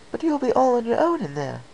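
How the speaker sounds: high, worried lady unicorn; Rarity's voice. A narrator's reading. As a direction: raise the pitch, anxious and puzzled.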